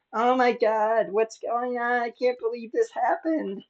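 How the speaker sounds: high, imitated disbelief; hammed-up shocked lament; mock dismay. Voicing upset Democrats in disbelief.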